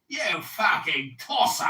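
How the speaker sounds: slow, shouted insult; angry insult. Real, not acted. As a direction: hurl it at him, slowly. Heated insult in argument.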